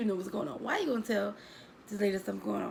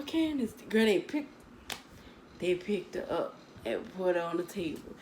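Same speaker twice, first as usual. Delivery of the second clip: ordinary talk, nothing marked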